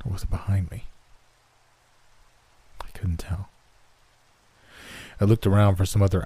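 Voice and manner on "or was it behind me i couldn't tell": hushed unvoiced aside; whisper. A narrator's reading. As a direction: breathe the line out in a whisper. Unsettled narrator, unvoiced.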